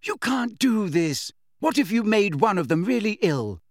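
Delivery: high, indignant remonstration; worked-up telling-off; alarmed scolding. A narrator's reading. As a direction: plead crossly, pitched well up. Hermione scolding in a quarrel.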